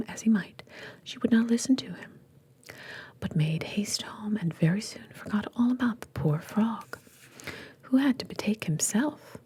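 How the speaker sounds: plain reading voice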